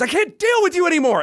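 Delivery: high-pitched mock exasperation; flustered. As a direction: imitate a flustered man giving up. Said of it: "He gets so flustered, he goes".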